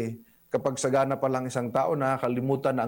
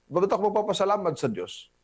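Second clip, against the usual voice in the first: mock arrogant vlogger; 7 semitones higher than usual. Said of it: "ang sabi niya nung siya sumagana".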